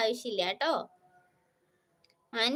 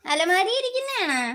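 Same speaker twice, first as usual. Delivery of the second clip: loud retort; 7 semitones higher, 7 dB louder than usual. Real, not acted. Answers a viewer emphatically.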